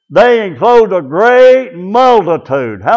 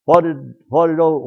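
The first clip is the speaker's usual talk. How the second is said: normal talking voice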